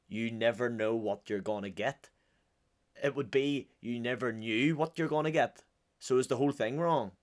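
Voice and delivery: normal talking voice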